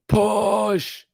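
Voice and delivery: high, loud, drawn-out strained yell; Joe Rogan. Acted. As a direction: cry it out like a delivery-room coach. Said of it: "Joe Rogan saying".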